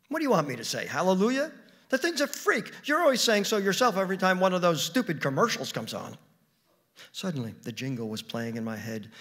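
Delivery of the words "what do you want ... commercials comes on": loud, high, indignant outburst; exasperated. Acted. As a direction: fire back, loud and scornful. Acted quarrel over the pet.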